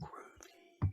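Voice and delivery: breathy whispered "Groovy" with no voice at all; soft whisper of "Groovy" from the departing businessman. Retold or imitated. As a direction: mouth "Groovy" almost silently, a secret nod. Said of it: "He didn't say it".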